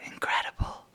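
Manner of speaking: hushed, amazed whisper; awed whisper. Acted. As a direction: breathe it in pure wonder. Awed kid breathes.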